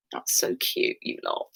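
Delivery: soft, breathless whisper, close to tears; barely voiced, touched by the class; choked-up whisper. Real, not acted. Moved by students' kind messages.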